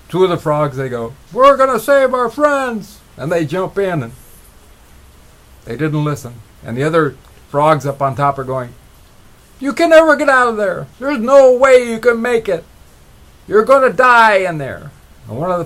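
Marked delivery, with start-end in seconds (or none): 1.3-3.0 high, loud and slow declaration; heroic call. Retold or imitated. Frogs declare rescue. 9.6-14.9 high, loud jeering shout; discouraging yell. Retold or imitated. Frogs on top discourage.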